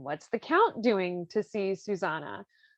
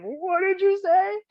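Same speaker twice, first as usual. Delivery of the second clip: high, slow, louder mock menace; menacing mock count; 10 semitones higher, 5 dB louder than usual. Count rising from the chair, slow mock threat. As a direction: loom over him with each word. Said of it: "he just comes up like".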